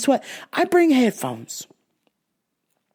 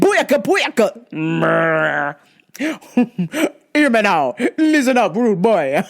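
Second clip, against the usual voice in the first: mocking Jamaican accent; slower than usual. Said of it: "never do that again".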